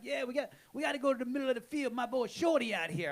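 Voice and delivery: high, quick New Orleans street voice; Shorty's New Orleans accent. Retold or imitated. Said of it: "that's a great accent".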